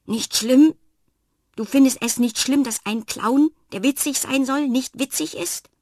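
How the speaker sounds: loud, high furious clown; little clown bursts out in rage; angry outburst. A narrator's reading. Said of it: "So einen Wutausbruch". (wütender Ausbruch)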